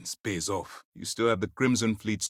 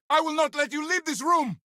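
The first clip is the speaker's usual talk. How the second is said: high, forceful yell; shouted order. Acted. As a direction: shout it to stop someone leaving. Forbidding someone to leave.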